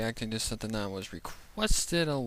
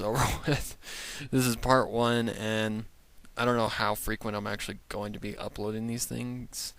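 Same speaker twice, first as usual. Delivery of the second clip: normal talking voice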